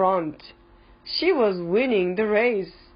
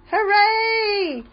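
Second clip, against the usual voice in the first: high, loud storybook cheer; cheering call; 12 semitones higher, 5 dB louder than usual. A narrator's reading. Biff and Chip as Gran wins the race, high loud cheer. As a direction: cry out in high celebration. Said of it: "called Biff and Chip".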